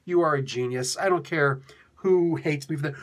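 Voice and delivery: usual speaking voice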